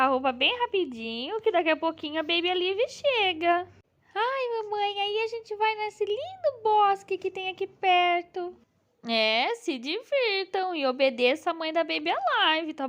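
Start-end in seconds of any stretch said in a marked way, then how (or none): 4.1-8.6 put-on little girl voice. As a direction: play the eager daughter doll. Bela doll talks about the woods.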